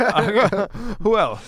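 laughing through the words, high and loud; can barely get the words out for laughing; giggling while trying to go on with the story. Real, not acted. Laughing after teasing.

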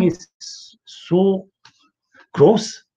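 high, drawn-out disgust on gross. Real, not acted. Preacher recoiling in disgust before the congregation.

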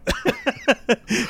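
real high-pitched laughing burst. Real, not acted. Joker breaking up during his own confession, high laugh. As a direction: burst out laughing mid-sentence.

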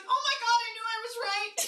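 high-pitched imitated indignation; mock outburst. [name] in her imagined fight with Matt, high mock outburst. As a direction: leap up high in mock vindication.

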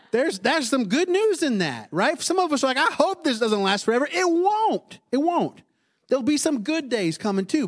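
high-pitched, exclaiming pulpit voice; impassioned preaching. Real, not acted. Preacher assuring struggling congregation it won't last, high impassioned exclamation. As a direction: cry it out as emphatic good news.

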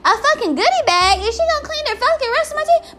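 high, fast exasperated squeal; rising shock at the goodie bag; outraged disbelief. Real, not acted.

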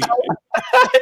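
very high, squealing fake cry; mock sob. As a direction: wail like a hammed-up crybaby. Mimicking an ugly cry.

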